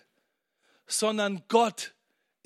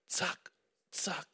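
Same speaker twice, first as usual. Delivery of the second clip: whispered zack; 6 dB quieter than usual. Shown as a demonstration. Preacher whispering zack, Zack to show a flashing light.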